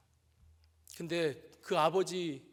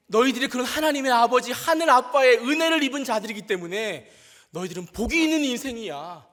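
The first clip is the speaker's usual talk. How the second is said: loud, fervent preaching voice, slowing on key words; impassioned sermon climax; preaching at full force; 6 semitones higher, 11 dB louder than usual. Acted. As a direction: preach it full out to a room of kids. Retold preaching to children, loud.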